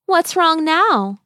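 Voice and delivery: high, slow fairy voice; sprite voice. A narrator's reading. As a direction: ask it like a sprite. Sprite asks the boy.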